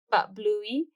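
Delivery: usual speaking voice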